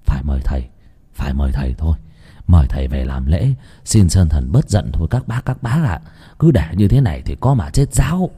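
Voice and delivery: put-on an old villager pleading with neighbours; lightly voiced old village man. Village elder urges a ritual.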